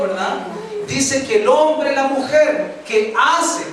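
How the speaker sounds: slow, loud preacher's build; preaching. Acted. The pastor preaches to his flock in a slow, loud, rising voice. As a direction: pound the point home to the congregation.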